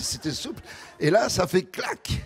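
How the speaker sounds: normal talking voice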